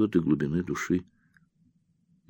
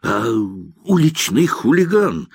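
high, slow, choking with rage; breathless outraged accusation; 8 semitones higher, slower than usual. Acted. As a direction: struggle for breath between the insults. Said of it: "задыхаясь".

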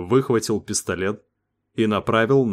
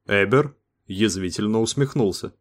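ordinary delivery

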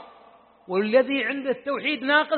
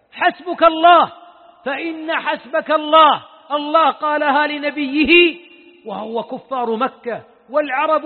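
loud, slow, ringing preacher's call; preaching at full force; 4 semitones higher, 7 dB louder, slower than usual. Acted. Preacher urging his listeners, loud and slow. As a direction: drive each الله home to the crowd.

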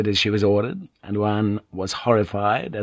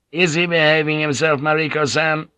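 higher, louder impatient question; irritable; 7 semitones higher, 3 dB louder than usual. Acted. Stern commander irritably questions the lady about the barbarian. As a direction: demand it with mild irritation. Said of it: "Iron Fist ask irritably".

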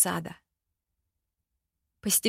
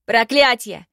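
loud furious curse; helpless rage; 3 semitones higher, 8 dB louder than usual. Acted. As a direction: spit the curse in helpless fury. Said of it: "в бессильной ярости".

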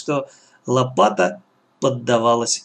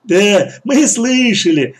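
loud, slow, higher mocking voice; jeering brothers; 7 semitones higher, 6 dB louder, slower than usual. A narrator's reading. Brothers side with the gang boss, laughing mockery. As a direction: draw it out loudly, jeering along. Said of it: "засмеялись братья".

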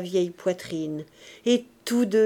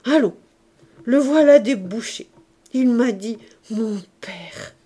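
put-on overcome old man; 3 semitones higher, 7 dB louder than usual. A narrator's reading. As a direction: start loud and high, end tenderly. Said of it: "le vieillard bégaya".

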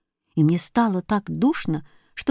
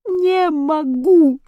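high, long drawn-out wail; slow, high despair; despairing cry; 8 semitones higher, much slower than usual. A narrator's reading. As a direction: cry out helplessly, stretching each word. Hero crying out in helplessness.

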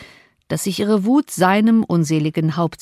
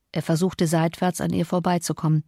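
ordinary delivery, nothing marked

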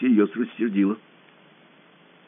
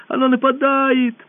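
high, loud, slow alarm; girl shouting a warning to Pashka; 12 semitones higher, 4 dB louder than usual. A narrator's reading. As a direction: cry out that it attacks. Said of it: "крикнула Алиса".

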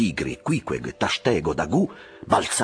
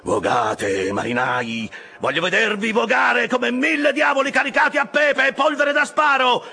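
building to a very high, loud holler; rousing yell; 6 dB louder than usual. A narrator's reading. Officer demanding more from his oarsmen, yell rising to full force. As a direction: drive the rowers on, ending at full voice. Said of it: "urlò Stubb".

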